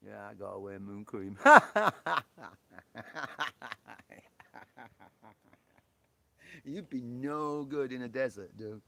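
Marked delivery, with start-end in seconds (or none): 1.4-5.5 loud laugh. Real, not acted. Streamer laughing at his own joke, loud burst. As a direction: crack up loudly.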